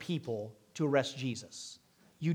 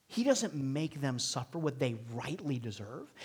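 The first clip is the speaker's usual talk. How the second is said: hushed, whispered conviction; soft unvoiced sermon whisper; 4 semitones lower, 7 dB quieter than usual. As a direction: draw them in with a whisper.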